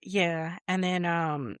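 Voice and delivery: usual speaking voice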